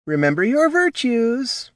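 high-pitched chiding; high, scolding reminder. A narrator's reading. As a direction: chide them like wayward pupils. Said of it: "chided the Counselor of Owls".

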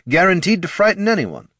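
overeager praise, pitched high; high, chirpy marketing exec. Acted. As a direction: gush like a cheerful salesman. Marketing head.

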